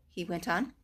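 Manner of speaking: plain reading voice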